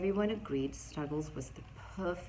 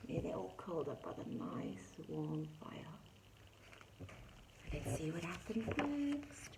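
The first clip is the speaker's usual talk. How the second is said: ordinary delivery, nothing marked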